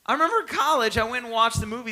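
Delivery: high, loud eager restart; excited. Real, not acted. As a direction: burst out, thrilled.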